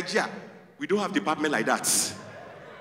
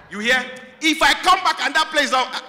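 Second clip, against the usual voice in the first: loud, higher threat that trails off unfinished; threat left hanging; 8 semitones higher, 5 dB louder than usual. Retold or imitated. Nigerian mom threatening her child, loud and high, trailing off. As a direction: threaten loudly and leave it hanging. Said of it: "In Nigeria, they leave the threat hanging".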